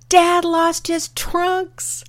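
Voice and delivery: high, drawn-out, hammed-up exclamation; mock amused mom's cry. Mom laughing at grandfather out in the surf, mock amused cry. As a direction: call it out as mom realizing, stretched high. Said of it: "suddenly starts laughing".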